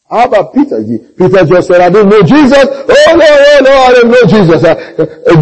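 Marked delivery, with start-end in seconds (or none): 1.8-4.6 loud, high mock protest; panicked denial. Peter disowning Jesus, mock panicked denial. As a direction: frantically deny it, hammed up.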